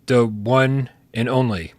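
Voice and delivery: slow announcement with pauses; slow, deliberate read. Real, not acted. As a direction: draw out each part of the name. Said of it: "I have to read that slow".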